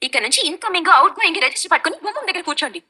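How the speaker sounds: jab at her with irony; sarcastic. Real, not acted. Wife mocking mother-in-law's watchfulness, sarcastic. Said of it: "మీ పిల్ల మాటల్లో లవంగాలు".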